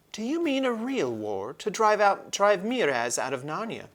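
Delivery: put-on a young king amazed at the idea of war; young prince. A narrator's reading. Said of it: "asked Caspian".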